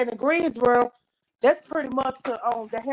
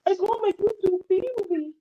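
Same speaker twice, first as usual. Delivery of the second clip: high, sweet put-on greeting voice; high sing-song greeting; 9 semitones higher than usual. Vlogger coos a greeting to her YouTube family, high and sweet. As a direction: coo it up high like a cartoon host.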